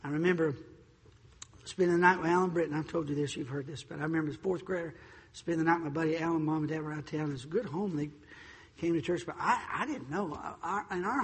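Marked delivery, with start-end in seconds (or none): none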